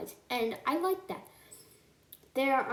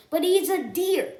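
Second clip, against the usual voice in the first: high, loud disbelief; incredulous exclamation; 8 semitones higher, 7 dB louder than usual. Real, not acted. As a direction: exclaim it in baffled protest. Incredulous complaint about the animation.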